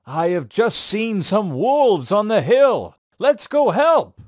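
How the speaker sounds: rally the villagers to go help; mayor. Acted. Mayor urging the villagers to chase the wolves, voice rising. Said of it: "He said to the villagers".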